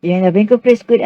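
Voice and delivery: ordinary talk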